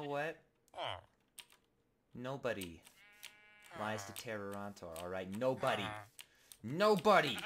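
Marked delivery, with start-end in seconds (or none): 5.4-7.4 building from firm to loud, high shout; mock rage. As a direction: escalate the threat to a yell. Building threat at Tim.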